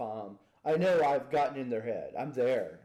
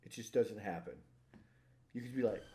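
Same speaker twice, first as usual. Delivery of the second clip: ordinary talk, nothing marked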